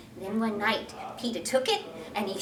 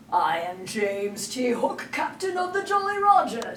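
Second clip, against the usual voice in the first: mock Hook; 4 dB louder than usual. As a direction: announce it pompously. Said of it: "trying not to laugh".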